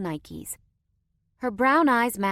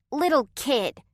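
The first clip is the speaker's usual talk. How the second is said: put-on higher, louder offended child; indignant; 7 semitones higher, 5 dB louder than usual. A narrator's reading. Girl protesting her brother's words, indignant. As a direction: take offense at being called little. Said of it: "Chloe said indignantly".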